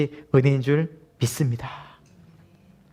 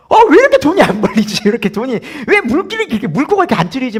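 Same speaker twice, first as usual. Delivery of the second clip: frustrated grumble; 9 semitones higher, 7 dB louder than usual. Retold or imitated. Grumbling about low salary.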